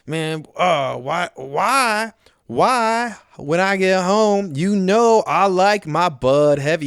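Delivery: hammed-up entitled husband griping at home; mock demanding rant. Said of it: "You start thinking, like".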